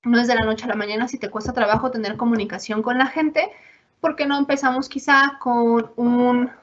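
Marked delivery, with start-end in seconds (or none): none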